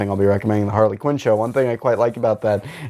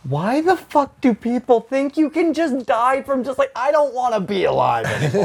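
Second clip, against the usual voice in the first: incredulous rant; 12 semitones higher than usual. Retold or imitated. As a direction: ask it like you can't believe anyone thinks this. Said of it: "I'm like".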